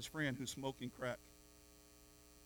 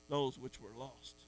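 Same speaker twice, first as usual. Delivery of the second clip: ordinary talk, nothing marked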